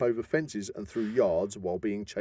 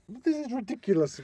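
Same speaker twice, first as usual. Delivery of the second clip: higher, exasperated complaint; mock exasperation; 12 semitones higher than usual. Telling the co-host about losing, mock exasperated. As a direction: throw up your hands at the ref. His retold complaint in the bout.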